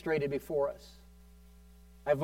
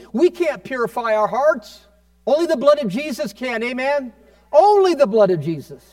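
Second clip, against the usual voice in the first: impassioned preaching, higher and building louder; raised voice; 7 semitones higher, 7 dB louder than usual. Real, not acted. Preacher urging the congregation to come to Jesus, raised voice. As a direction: preach it out, voice climbing to a loud finish. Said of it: "Sorry if I'm raising my voice".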